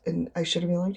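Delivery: usual speaking voice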